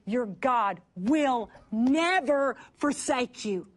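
usual speaking voice

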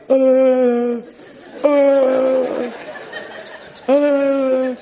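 high, muffled voice as heard from inside the womb; muffled daddy voice. Retold or imitated. Preacher mimics the dad heard from the womb, muffled. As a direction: mimic a father's voice through the belly. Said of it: "They hear the father's voice outside like this".

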